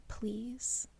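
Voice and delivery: soft unvoiced plea; pleading whisper. Feyre begging Tamlin, whispered. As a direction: beg in a whisper. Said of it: "looks at him and says".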